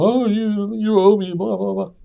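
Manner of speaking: mocking nag. Mimicking a lender nagging about twenty bucks, mocking. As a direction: parody someone nagging about a debt.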